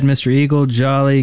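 usual speaking voice

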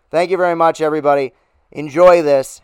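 usual speaking voice

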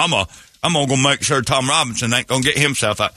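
hammed-up Southern defense lawyer; bumbling country attorney in a Southern accent; mock Southern accent.

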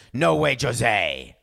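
loud, drawn-out refusal; Ben Stiller. Acted. Blue Jays turning down Jose's seven years, emphatic. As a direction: stretch it out loud and slow. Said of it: "said Ben Stiller".

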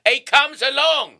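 working-class British bluster, building to a shout; Michael Caine. Shown as a demonstration. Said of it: "you're Michael Caine".

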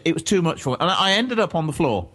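ordinary talk, nothing marked

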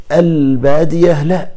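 البادية drawn out, high and loud; stressed Bedouin. Shown as a demonstration. (البادية بصوت عالي وممطوط)

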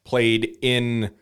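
ordinary delivery

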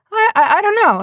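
high-pitched stammered retold reply; mock a man caught helpless by a question; stumped.

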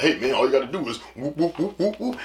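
fast, lightly voiced buddy rattling off steps; mock know-it-all friend. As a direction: breeze through it like it's easy. Friend explaining tire change, mocked.

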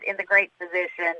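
usual speaking voice

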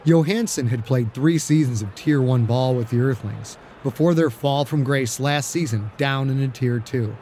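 ordinary delivery

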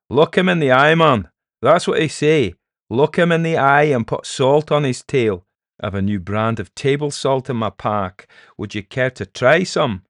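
loud, lively Scots sales patter; jeering Scottish peddler. Acted. Peddler offering salt for the monster's tail, jeering. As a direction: goad him like a cheeky hawker.

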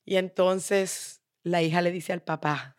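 plain reading voice

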